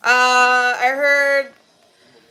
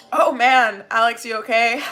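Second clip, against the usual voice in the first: ordinary delivery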